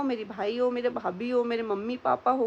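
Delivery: usual speaking voice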